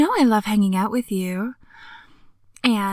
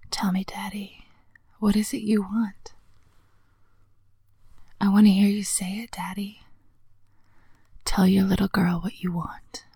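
teasing whisper; 3 dB quieter than usual. Acted. Whispered seduction roleplay.

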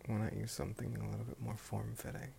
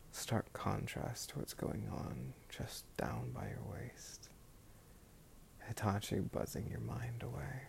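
right in your ear; slower than usual. Acted. Intimate whisper right in your ear.

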